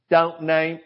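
imitating a soft Mississippi drawl; a hint of a soft Southern Mississippi voice; soft Mississippi accent. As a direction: lightly do the sister's Mississippi accent. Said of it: "with that soft Mississippi accent".

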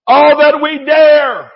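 slow, loud, lifting on dare; 1 dare stressed. Real, not acted. Preacher urging church to take God's dare, slow loud. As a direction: hammer the word dare slowly.

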